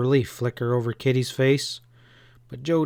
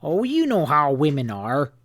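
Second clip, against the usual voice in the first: disgusted grunt; 2 semitones higher, 3 dB louder than usual. A narrator's reading. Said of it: "Dems grunted with disgust".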